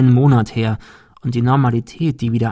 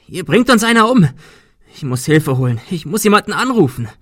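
higher, loud frantic yelling; panicked shout; 7 semitones higher, 3 dB louder than usual. Acted. Adrian panicking, yelling he must call help.

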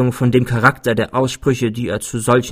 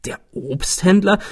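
puzzled echo, pitch rising high; astonished question; 8 semitones higher than usual. A narrator's reading. Narrator questions his friend's answer, astonished. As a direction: query it in surprise. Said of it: "Sie setzen mich in Erstaunen".

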